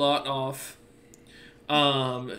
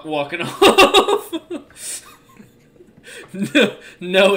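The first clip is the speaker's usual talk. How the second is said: laughing; 5 semitones higher, 9 dB louder, much slower than usual. Real, not acted. GM cracking up describing the dwarf.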